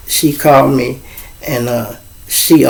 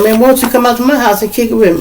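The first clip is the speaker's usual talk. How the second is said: quick, high-pitched invite; girl's voice; 8 semitones higher, faster than usual. Retold or imitated. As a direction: rattle it off high like the girl. Girl inviting him over.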